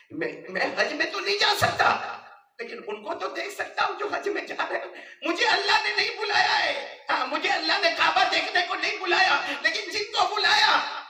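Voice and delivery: loud, high, crying-out sermon; fervent preacher's cry; full-force preaching. Acted. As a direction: shout the boy's longing to the crowd. Preaching the boy's words.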